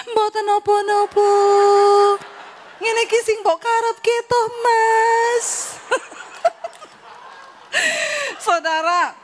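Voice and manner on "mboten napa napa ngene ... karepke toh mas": higher, louder sugary voice; mock-refined wife. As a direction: overdo the sweet Solo wife.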